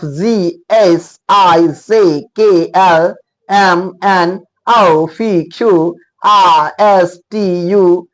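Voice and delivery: each letter read out slowly, one by one; slow, deliberate letter-by-letter drill; slow alphabet recitation. Shown as a demonstration. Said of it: "जेड नहीं कह करके जी".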